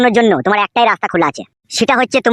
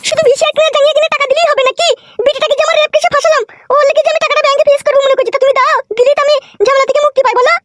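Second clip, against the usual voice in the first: high squeaky put-on woman's voice. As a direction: demand the money as a sharp-tongued wife in a falsetto. Plays wife Narkel Begum. (উঁচু গলায় মেয়েলি স্বরে টাকা চাওয়া বউ)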